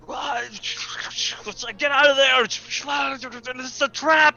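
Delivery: high, loud frantic shout; panicked warning. Pretend. Distorted speaker voice warning of a trap, high panicked shouting. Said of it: "and it's like".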